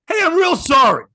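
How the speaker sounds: high, loud retold stage apology; apology thrown out loud and high; loud mock apology. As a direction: belt out a joking apology.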